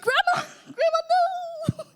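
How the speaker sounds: very high, slow, laughing through tears; choked laughing protest; laugh-crying. Retold or imitated. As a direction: break down laughing in disbelief. Laughing-crying at grandma's joke.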